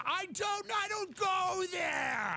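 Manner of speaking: high yelped comic excuse; mock defensive yelp. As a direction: blurt it out high like you've been caught. Mocking answer to a rhetorical question.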